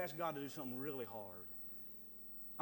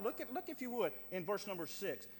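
ordinary delivery

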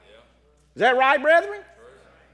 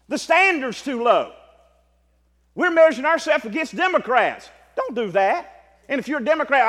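full-force preaching; 6 semitones higher, 6 dB louder than usual. Acted. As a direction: drive each line home to the congregation.

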